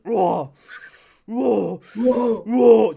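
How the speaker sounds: hammed-up weightlifter grunting, swelling to a bellow; mock gym grunts. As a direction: grunt on every rep, building up. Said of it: "todos los movimientos".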